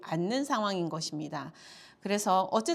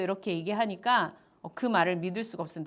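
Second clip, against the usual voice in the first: ordinary talk, nothing marked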